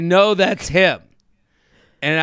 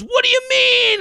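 far higher, mock-innocent squeak; baffled dad. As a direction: play the dad acting clueless, very high. Dad's baffled reply.

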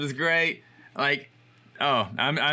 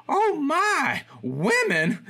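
put-on high-pitched, louder gasp of an old lady scandalized; shocked old lady; 3 dB louder, much slower than usual. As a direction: play the sweet old shopkeeper nearly fainting at the sight. Old shop lady shocked by photo.

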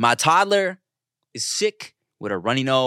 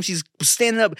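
usual speaking voice

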